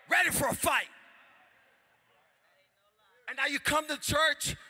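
loud, driving sermon delivery; full-force preaching. Real, not acted. Preacher challenging the congregation to praise, full-force preaching. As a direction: preach it out to the crowd.

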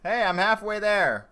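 high, loud, drawn-out call; loud protest. Real, not acted. Player halfway through the night, loud high protest. Calls out in the game.

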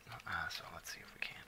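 whispered aside; under-the-breath whisper. Real, not acted. Muttered aside while playing.

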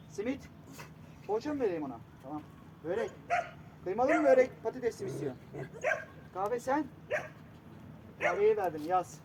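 usual speaking voice

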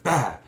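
quick high blurt, imitating a girl; imitated girl's outburst. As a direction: mimic a sudden startling yelp. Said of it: "when the girl went".

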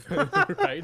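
a high laughing squeal. Real, not acted. Laughing after the story.

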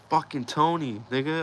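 high-pitched, indignant imitation; retold anger. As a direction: act out a homie's outrage. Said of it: "he got hella mad".

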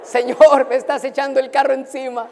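an excited pastor's loud aside to the Lord. Retold or imitated. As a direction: cry out thrilled that it's happening. Excited reaction to her call.

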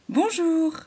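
high, bright greeting in chorus; chorus of animals. A narrator's reading. The animals greet the halo together, bright chorus. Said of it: "répondirent en chœur les animaux".

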